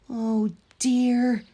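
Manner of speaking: an engine caught out; rueful Thomas. A narrator's reading. Thomas stuck in the hole, ruefully. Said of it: "he said".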